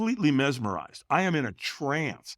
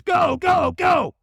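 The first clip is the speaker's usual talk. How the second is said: loud, high-pitched shouted send-off; urgent shout; 5 dB louder, much slower than usual. Retold or imitated. Trainer launches firewalker onto coals, urgent shout. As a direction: imitate a trainer yelling him forward.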